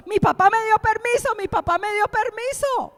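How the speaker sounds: put-on high, loud gleeful kid; child bragging to his friends, high and loud; excited child. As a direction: crow it to your friends, thrilled. Son celebrating to friends.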